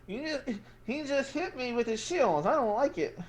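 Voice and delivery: put-on whiny kid telling on him; complaining gnome kid. Gnome kid complains about the shield, high and whiny. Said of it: "the one with the nose is".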